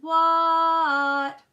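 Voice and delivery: long, high, loud shout; yelled reply. A narrator's reading. Froggy answering Dad through the house, loud holler. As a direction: holler it back, held out.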